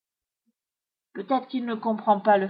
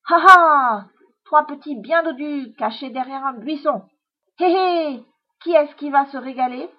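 loud villainous chuckle and taunt; crowing storybook fox; gloating fox; 3 semitones higher, 7 dB louder than usual. A narrator's reading. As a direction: gloat over your next meal. Fox gloats over his prey.

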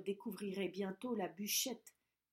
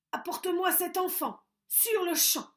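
much higher, louder ogre bellow; ogre commanding; 8 semitones higher, 8 dB louder than usual. A narrator's reading. Ogre ordering the witch to hand over the child. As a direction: bark the order, demanding.

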